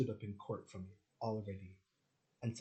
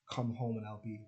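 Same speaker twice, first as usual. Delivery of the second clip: usual speaking voice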